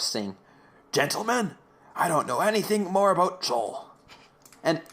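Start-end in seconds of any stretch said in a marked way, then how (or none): 0.9-3.9 higher, lightly voiced Don Polhaus imitation; a hint of Don Polhaus. Imitating Don Winters in the scene, a light Polhaus voice. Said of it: "sounding just like Don Polhaus".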